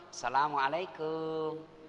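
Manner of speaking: slow, drawn-out polite greeting. Acted. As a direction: greet her sweetly and slowly. Polite greeting acted slowly. (salam sopan yang pelan)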